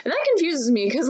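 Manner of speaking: ordinary talk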